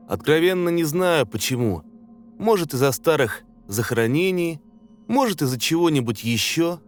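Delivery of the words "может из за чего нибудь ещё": plain reading voice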